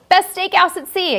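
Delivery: announced loud. Shown as a demonstration.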